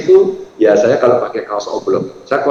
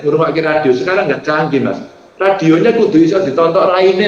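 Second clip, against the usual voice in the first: usual speaking voice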